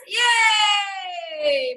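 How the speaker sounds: loud high cheer; cheering yay. Shown as a demonstration. Teacher leading the class cheer. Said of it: "Now we're going to say in Arabic".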